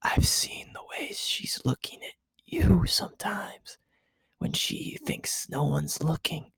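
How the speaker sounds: hushed secret whisper, leaning in; conspiratorial whisper. A narrator's reading. Misato confiding in Shinji about Asuka, whispering. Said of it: "dropped her voice into conceptual whisper".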